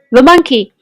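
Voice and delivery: usual speaking voice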